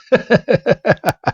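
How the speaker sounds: high staccato mock laugh; acted derisive cackle; mocking laughter. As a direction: snicker smugly at your rival. Blue laughs at Red.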